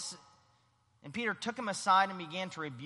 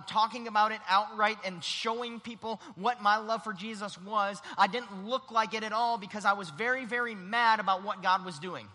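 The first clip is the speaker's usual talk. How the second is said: ordinary delivery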